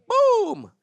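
sudden loud high boom; shotgun boom. Retold or imitated. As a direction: blurt out the gunshot noise. Shotgun fires at squirrel.

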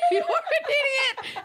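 high, slow, laughing shout; squealing shout. Shown as a demonstration. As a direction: shriek it out, laughing. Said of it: "is the actual correct sound".

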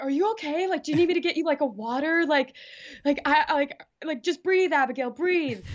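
mock-fret over someone who just collapsed; imitating panicked driver. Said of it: "the driver was, like, freaking out and being like".

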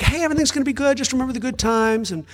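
high bright fake cheer, settling down; sing-song forced optimism; cheerful voice hurrying past grief; mock cheery. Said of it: "We're like".

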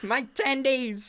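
high, slow, whiny demanding voice; whiny man-child voice. Pretend. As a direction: whine like a spoiled man-child. Continuing the neckbeard voice.

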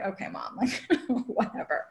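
soft laughing brush-off; light amused eye-roll to mom. Retold or imitated. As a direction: laugh it away, half-dismissive. Brushing off mom's advice.

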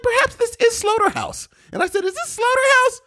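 very high, put-on voice of dawning realization; high mock wonder. Retelling recognizing Slaughterhouse, high mock wonder. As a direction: retell a discovery in a squeaky high pitch.

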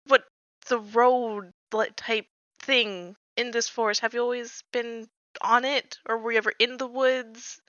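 normal talking voice

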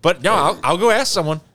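hammed-up earnest phone protest; imitated protesting husband. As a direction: mimic a husband swearing his lie is true. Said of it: "He's like".

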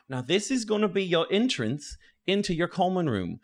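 lightly voiced tour guide; Gallagher's voice. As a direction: present it to new students. Game master voices Gallagher.

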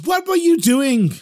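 high, drawn-out indignant question; exasperated reproach. A narrator's reading. Innkeeper calls his daughter out, exasperated reproach. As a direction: stretch it out in high-pitched disbelief.

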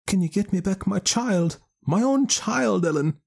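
high, distressed Irish mother; grieving mother voice. Acted. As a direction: lament her stolen child. Mother pleads with great energy.

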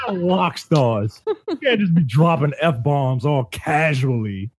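wag a finger at the nerds, half laughing; mock lecture. Host mock-lectures game developers.

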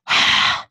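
one loud, breathy exhale; heavy breathing. A narrator's reading. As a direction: breathe heavily down the line like a creepy caller. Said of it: "she heard the heavy breathing again".

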